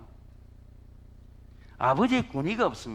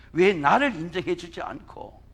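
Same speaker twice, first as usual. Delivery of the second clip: normal talking voice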